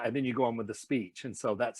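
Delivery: ordinary talk, nothing marked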